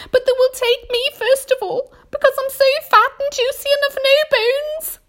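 very high, loud self-pitying panic; wailing earthworm. A narrator's reading. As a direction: wail loudly in a high worm voice. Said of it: "wailed the earthworm".

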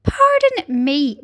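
ordinary delivery

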